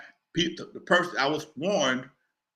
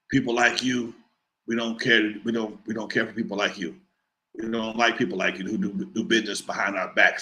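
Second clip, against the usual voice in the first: a much lower voice for the caller; menacing caller; 8 semitones lower than usual. Retold or imitated. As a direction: speak down low like a Jersey heavy making a threat. Caller threatens him.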